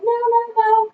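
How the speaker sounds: high-pitched innocent denial; feigned innocence. Retold or imitated. Friends hiding her phone deny seeing it, feigned innocence.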